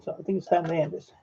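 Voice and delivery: ordinary talk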